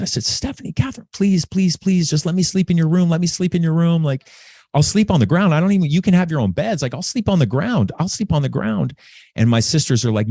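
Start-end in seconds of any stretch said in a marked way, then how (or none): 0.2-9.0 put-on much higher pleading little-kid voice; begging child. Little brother begging his sisters to share their room, high pleading. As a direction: beg like a small boy desperate not to be alone. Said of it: "I remember begging my sisters".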